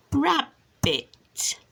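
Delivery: rab, bit as two short beats with a gap; syllable by syllable. Shown as a demonstration. Showing two syllables to a child, syllable by syllable. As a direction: break rabbit clearly in two. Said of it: "two syllables".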